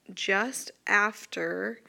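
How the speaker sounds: teacher marks the split point by slowing on "just after"; stressed phrase. Shown as a demonstration. Teacher to students on where words split, slow stressed "just after". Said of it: "the blend or the digraph".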